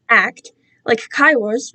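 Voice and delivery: ordinary delivery, nothing marked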